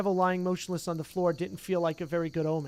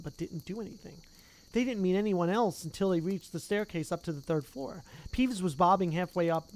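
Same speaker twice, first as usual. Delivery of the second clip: ordinary delivery, nothing marked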